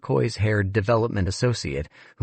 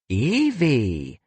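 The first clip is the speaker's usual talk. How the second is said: sneer; 6 semitones higher than usual. Acted. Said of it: "Fran sneered".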